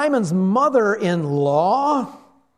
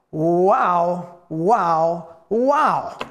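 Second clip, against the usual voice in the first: amazed; 4 dB louder, much slower than usual. Acted. Preacher marvels at the scene.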